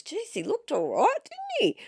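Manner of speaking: high, clueless mock dad voice; naive dad. Daughter imitating her dad, high and clueless. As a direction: play the oblivious father, rising high. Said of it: "He goes".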